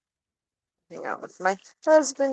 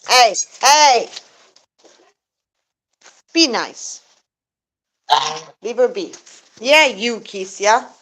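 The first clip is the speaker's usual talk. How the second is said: loud sharp warning; scolding; 8 dB louder than usual. Real, not acted. Owner scolding Keisha for bothering another cat, loud warning. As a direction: tell the cat off firmly.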